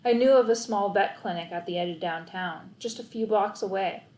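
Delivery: ordinary delivery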